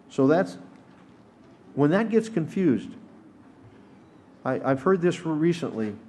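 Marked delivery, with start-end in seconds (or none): none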